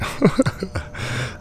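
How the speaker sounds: soft unvoiced laugh; airy chuckle to himself; breathy laugh. Real, not acted. Laughing alone in hot tent.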